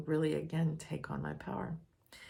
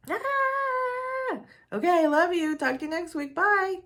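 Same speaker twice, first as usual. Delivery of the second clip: chirpy, much higher singsong goodbye, fast; breezy sign-off; 13 semitones higher, 7 dB louder, slower than usual. Retold or imitated. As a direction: cheerfully wrap up the call and hang up. Cheerfully hanging up again.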